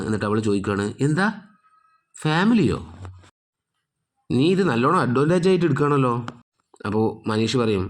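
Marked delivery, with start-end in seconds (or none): 1.1-6.1 higher, indignant angry voice; furious retort. A narrator's reading. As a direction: throw the word back at him in rage. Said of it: "മേഘക്ക് കലി തുള്ളുകയാണ്". (കലിയോടെ ഉയർന്ന സ്വരത്തിൽ)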